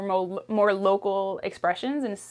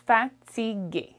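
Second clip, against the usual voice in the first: ordinary talk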